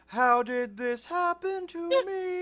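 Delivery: high, very slow wail; dramatic soundtrack voice. Pretend. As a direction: draw out a mournful lament. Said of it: "you hear on the soundtrack".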